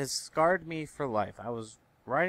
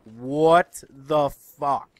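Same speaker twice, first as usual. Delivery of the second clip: loud, very drawn-out outburst; slow, incredulous exclamation; outraged disbelief; 9 dB louder, much slower than usual. Retold or imitated. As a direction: stretch every word out in loud disbelief. Acted outraged reaction to woman sitting.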